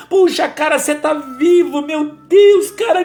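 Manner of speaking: high, tearful exclamations of relief; a touch of mock sobbing; crying friend. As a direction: voice him as a friend weeping with joy. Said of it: "ele chorando".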